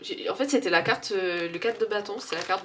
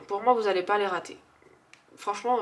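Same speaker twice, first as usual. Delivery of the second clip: ordinary talk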